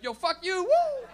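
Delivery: loud, high whooping jeer through a laugh; cocky whoop; gleeful taunt. Retold or imitated. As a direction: crow it at the cars you just passed. Taunting drivers he passed.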